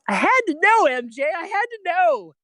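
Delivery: high-pitched, hammed-up desperate plea; desperate insistence. Student burning to ask his question, mock-desperate. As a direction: push it way up high, pleading.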